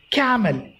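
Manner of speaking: camel as CAM-el, first syllable stressed. Shown as a demonstration. Teacher showing students the correct word, camel stressed on CAM-. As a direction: hit CA in "camel", let -mel drop. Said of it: "C A must be stressed".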